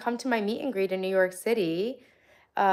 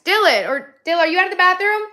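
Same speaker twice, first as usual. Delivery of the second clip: calling out; 10 semitones higher, 9 dB louder than usual. Real, not acted. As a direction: call loudly to someone in another room. Calling to son in bathroom.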